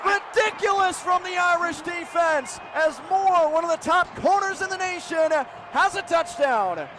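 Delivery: high-pitched, sustained commentator shout; thrilled live football call; ecstatic interception call. Real, not acted. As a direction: cry out the pick six with long, ringing words.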